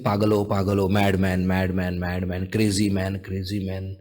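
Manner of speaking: low, repetitive mock jeer, fading; retold jeering. Everybody around calls him madman, low mock jeering. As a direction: repeat it low like a muttering crowd. Onlookers' repeated jeers, retold.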